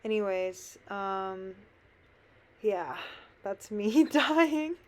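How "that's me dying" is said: laughing through the words, higher and louder. Real, not acted. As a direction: giggle while saying it. Laughing at her own struggle.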